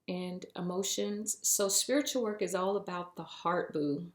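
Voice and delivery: usual speaking voice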